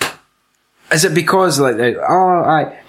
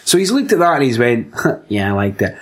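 imitating the director; 6 semitones lower than usual. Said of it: "he walks about with his wee stamp and he goes".

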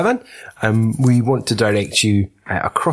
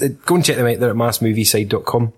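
usual speaking voice

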